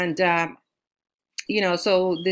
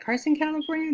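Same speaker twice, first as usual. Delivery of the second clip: surprised; 10 semitones higher, faster than usual. Retold or imitated. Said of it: "I was like".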